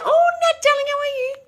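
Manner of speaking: high, loud cooing; gushing greeting. Real, not acted. Greeting a returning viewer.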